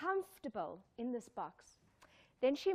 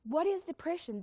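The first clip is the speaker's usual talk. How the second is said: ordinary delivery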